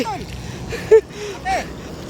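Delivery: long, high laugh; delighted laughter. Real, not acted. Angler after a big catch, high laughing. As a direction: let out a giddy high laugh.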